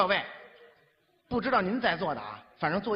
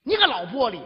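insulting shout; 4 semitones higher, 6 dB louder than usual. Retold or imitated. Insulting woman on bus.

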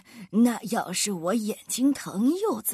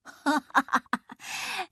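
astrologer's raised, staccato laugh; mocking guffaw; 4 semitones higher than usual. A narrator's reading. Astrologer hears the absurd remedy, mocking laughter. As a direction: laugh scornfully in character. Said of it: "星象家哈哈大笑".

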